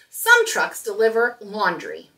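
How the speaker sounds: ordinary delivery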